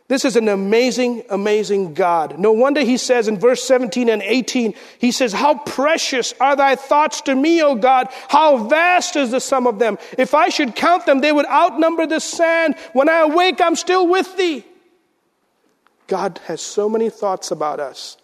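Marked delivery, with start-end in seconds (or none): 5.3-14.6 rising, higher scripture reading; climbing reverent recitation; fervent psalm reading. Acted. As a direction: read the psalm with mounting awe. Reads psalm with rising fervour.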